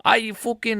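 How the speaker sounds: high-pitched stab at Scottish; badly faked Scottish; failed Scottish accent. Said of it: "I can't do a Scottish accent".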